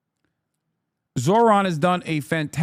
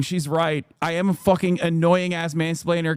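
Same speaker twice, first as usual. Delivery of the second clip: ordinary delivery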